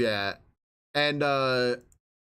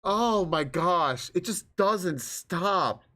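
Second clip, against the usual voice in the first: very slow, drawn-out dismay; weary exasperation; much slower than usual. Real, not acted. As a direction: drag each word out, utterly worn down. Weary of endless prose.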